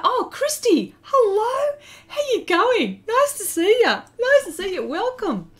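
high, loud, sing-song greeting; gushing welcome. Real, not acted. Welcoming a friend who just joined the stream, high and gushing. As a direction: greet a friend with bright delight. Greeting viewer arriving in chat.

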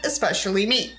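ordinary delivery

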